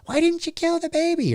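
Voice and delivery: high quoted voice. Retold or imitated. Quoting a phone caller, pitched high. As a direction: toss the line up high.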